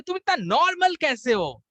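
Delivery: high mock exasperation; exasperated wife's question; imitating an irritated wife; angry wife. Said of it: "वो गुस्सा हो जाती है".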